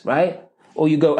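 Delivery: usual speaking voice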